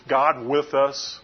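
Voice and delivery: ordinary talk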